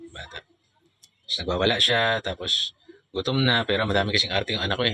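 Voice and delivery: ordinary talk, nothing marked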